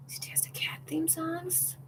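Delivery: hushed incredulous whisper; whispered disbelief. Real, not acted. Repeating the news to herself in a whisper. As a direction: whisper it, stunned.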